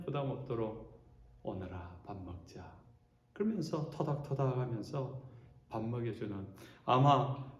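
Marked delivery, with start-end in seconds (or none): 1.4-2.7 much softer, slow and lower tender call; tender invitation. Acted. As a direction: coax gently, no pressure at all. Jesus gently calling disciples. (부담 없이 다정하게 부르는 목소리)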